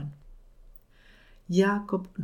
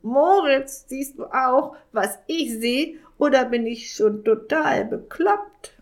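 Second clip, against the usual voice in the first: raven voice; 6 semitones higher than usual. Acted. Raven amazed at new feathers.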